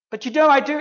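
high, loud insisting; insistent protest. Retold or imitated. Believer insisting.

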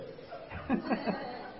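quiet unvoiced chuckle; a soft breathy laugh at his own joke; small airy laugh after a teasing line. Real, not acted.

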